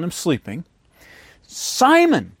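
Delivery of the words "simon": sharp call. Retold or imitated. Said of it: "he said to Peter".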